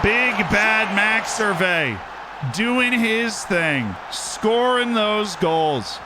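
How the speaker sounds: high, slow, drawn-out goal celebration; drawn-out cheering. Real, not acted. Gamer hails his striker's goals, drawn-out cheering. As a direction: stretch every word in high-pitched admiration.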